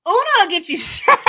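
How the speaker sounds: loud, high, laughing through the words; real laugh bursting out on the line. Real, not acted. Joking to viewers about cheating at Uno, laughing. Cracking up at her own recap.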